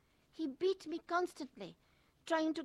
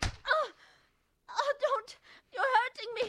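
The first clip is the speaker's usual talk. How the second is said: high, tearful cry of pain; 12 semitones higher, 3 dB louder, slower than usual. Acted. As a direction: cry out as he hurts you. Husband hurting her, she cries out.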